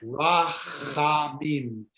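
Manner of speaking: rachamim, very slow, ch rasped in the throat; guttural ch. Shown as a demonstration. Teaching the class the ch sound, slow and throaty. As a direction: draw out rachamim with a scraped ch. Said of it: "getting that ch sound".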